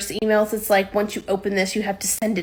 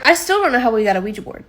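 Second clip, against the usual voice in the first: normal talking voice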